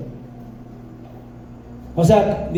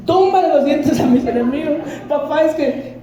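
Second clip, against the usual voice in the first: put-on higher, light child voice; child's voice; 7 semitones higher than usual. Preacher voices his son explaining the verse. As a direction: play the amused little son. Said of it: "son voiced in retold story".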